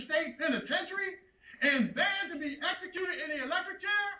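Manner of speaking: anguished accusation. Acted. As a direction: confront your condemned son, pitch climbing on the questions. Acted father confronting condemned son.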